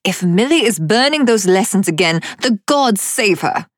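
high, loud, harassed yell. A narrator's reading. Adeline to Cyrus about the burning lessons, yelling. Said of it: "Adeline yelled".

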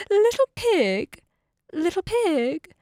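lightly voiced coaxing wolf; wolf calling. A narrator's reading. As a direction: call out to the little pig, starting high. Wolf calls to the pig.

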